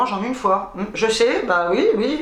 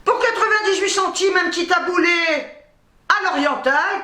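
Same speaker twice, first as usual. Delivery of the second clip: showy product announcement; 8 semitones higher than usual. Real, not acted. Presenting a product with flourish.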